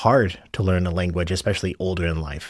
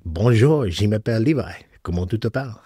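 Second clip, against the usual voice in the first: schoolboy French. Retold or imitated. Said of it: "I did learn French a little bit".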